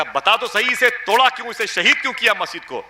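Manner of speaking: high, urgent retold interrogation; angry demand. Man demanding answers from the silent cleric, angry. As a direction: press him hard, pitch jumping up.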